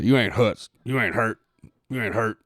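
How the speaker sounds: lightly voiced old coach catchphrase. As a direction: imitate a coach dismissing an injury, only lightly. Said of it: "he had this saying".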